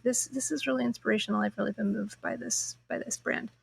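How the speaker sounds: from soft to whispered; fading to whisper. Retold or imitated. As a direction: let it sink into a whisper. Inner thought, fading to whisper.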